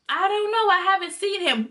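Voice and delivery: high-pitched innocent answer; imitated mom.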